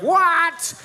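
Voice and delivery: high, loud shocked yelp; mock astonishment. [name] seeing him with the ring, mock shock.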